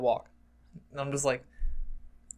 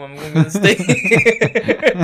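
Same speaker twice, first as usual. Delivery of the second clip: loud, slow, higher plea dissolving into laughs; breaking into laughter; 7 semitones higher, 8 dB louder, much slower than usual. Retold or imitated. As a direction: crack up while acting the plea. Cracks up retelling stoned plea.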